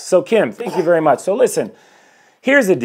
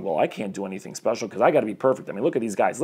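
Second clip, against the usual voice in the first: discouraged audience member; 6 semitones lower, 9 dB quieter, faster than usual. Retold or imitated. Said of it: "the audience members are going".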